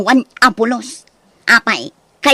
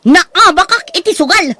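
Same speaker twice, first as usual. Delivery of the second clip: exasperated rant; 5 semitones higher, 3 dB louder than usual. Acted. Ranting after losing gambling.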